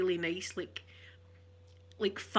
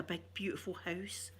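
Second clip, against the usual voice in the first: awed whisper; 9 dB quieter than usual. Retold or imitated. Awe at relatives' big house.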